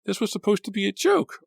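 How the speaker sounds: high panicked cry; shrill, high, frightened cry; shrill trembling cry. A narrator's reading. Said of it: "cried in a shrill, trembling voice".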